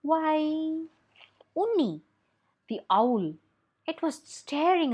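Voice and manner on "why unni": ordinary delivery, nothing marked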